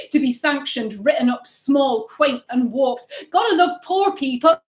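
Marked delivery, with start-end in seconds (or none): 3.3-4.6 mock snobbish aside.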